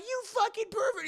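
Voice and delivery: very high, loud insult; mock furious girl. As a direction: spit it out at a pervert. Kiko slaps him.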